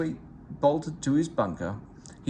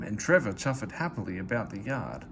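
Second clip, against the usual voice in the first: ordinary delivery, nothing marked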